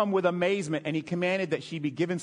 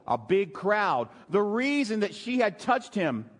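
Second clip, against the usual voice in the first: usual speaking voice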